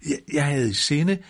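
high, stammered protest while falling; groaning. A narrator's reading. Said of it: "stønnede han". (stønnende, med lys stemme)

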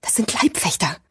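hushed, voiceless whisper. A narrator's reading. Gigi identifies the guards to Sirio, whispering. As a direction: whisper a tense warning. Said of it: "flüsterte Gigi".

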